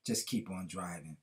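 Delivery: low, soft and unhurried reassurance; a steady dad calming his scared daughter; calm father. A narrator's reading. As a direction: settle her fear with a calm, quiet voice. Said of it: "The father calmly looked back at her and said".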